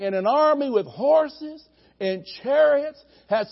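ordinary talk

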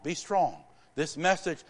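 ordinary talk, nothing marked

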